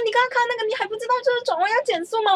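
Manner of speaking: imitated scolding instructor. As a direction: play the coach scolding the student. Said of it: "然后被骂爆".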